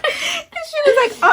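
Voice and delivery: high, loud laughing talk. Real, not acted. Recalling a friend's texts while laughing. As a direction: laugh as you start the story.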